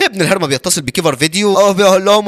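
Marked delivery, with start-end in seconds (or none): none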